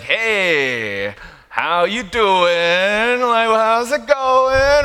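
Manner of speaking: very slow, stretched creepy-friendly hello; weirdly drawn-out greeting. Acted. Friend sidling up to greet you oddly, exaggerated drawl. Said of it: "say hello in a different way".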